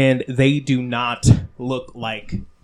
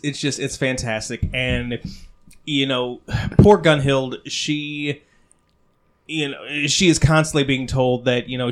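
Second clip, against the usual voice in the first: ordinary talk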